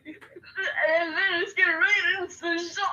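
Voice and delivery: high, slow, broken robot host voice; glitchy Kane. Pretend. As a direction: stretch it out like a glitching show host. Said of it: "glitchy impersonation of Kane".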